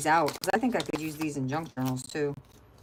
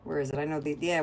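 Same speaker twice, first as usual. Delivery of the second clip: ordinary talk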